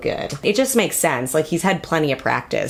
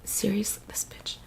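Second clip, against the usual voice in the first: whispered insult; 3 semitones higher, 14 dB quieter than usual. Acted. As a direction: hiss it under your breath. Aside before the intro.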